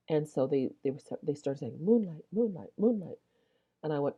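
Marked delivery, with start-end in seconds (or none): 1.8-3.2 lightly imitated searchers calling, higher pitch; mock calling out. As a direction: call out a code word without shouting, lilting higher. Searchers calling out the code word.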